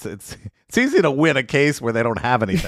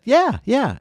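much higher, keen agreement; eager yes; 12 semitones higher than usual. Retold or imitated. Guest recalling saying yes to taking a hit, eager and high. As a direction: bounce up high, eager to please. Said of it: "the first couple times I said".

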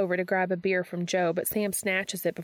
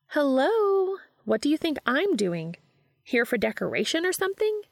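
pitched-up call from inside the apartment; calling from another room; 8 semitones higher, 3 dB louder than usual. A narrator's reading. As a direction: call out in a higher, louder voice. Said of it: "Charlie asks from inside the apartment".

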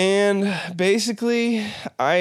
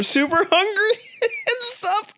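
laughing. Real, not acted. Laughs mid-sentence at the image.

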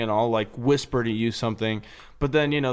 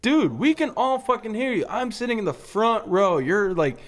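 high, exasperated call-out; exasperated rebuke; 8 semitones higher than usual. Acted. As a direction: call out a noisy offender, pitch jumping up. Imagined rebuke of loud host.

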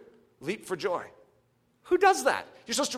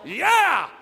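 loud cheer, pitched higher; yell; 6 semitones higher, 4 dB louder than usual. Retold or imitated. Preacher imagining a cheer with the enemy at the door, a loud yell. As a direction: cheer out with full confidence. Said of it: "it's sort of hard to yell".

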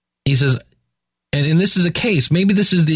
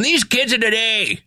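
lightly voiced cranky elder, higher; a mock grumble about kids these days; grumpy old-timer; 6 semitones higher, slower than usual. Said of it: "is that Jesus just going".

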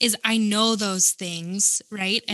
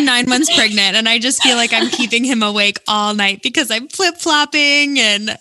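laughing; 7 dB louder than usual. Real, not acted. Laughing about keeping husband awake.

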